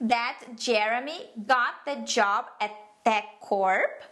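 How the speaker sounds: slow, clearly separated English phrases; careful example reading. Shown as a demonstration. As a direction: read it out slowly so learners can follow. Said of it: "ler aqui pra vocês entenderem melhor". (leitura lenta e pausada em inglês)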